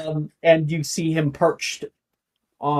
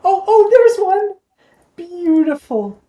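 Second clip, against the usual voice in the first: excited; 9 dB louder, slower than usual. Acted. GM voices owl spotting picture.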